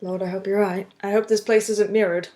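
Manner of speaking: ordinary talk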